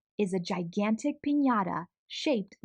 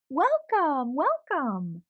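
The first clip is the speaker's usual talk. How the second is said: warm greeting; 5 semitones higher, 4 dB louder, much slower than usual. Acted. Aunt greets guests at door.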